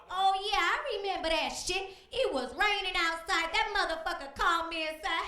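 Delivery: loud, high, brash plaintiff; high loud sassy woman voice. Acted. A brash plaintiff ranting on a court show in a high loud voice. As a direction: shout the story up high like a fired-up witness. Plays Latanya in a TV courtroom skit.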